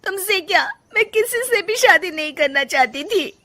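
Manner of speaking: high voice breaking into tears; upset, high-pitched wail of regret; tearful. Acted. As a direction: confess it as the crying starts. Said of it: "Rajni रोने लगती है".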